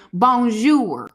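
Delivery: put-on loud Bonjour in a thick Southern country accent; Southern drawl French greeting. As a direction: ham up a country girl saying Bonjour. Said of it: "my country accent is very".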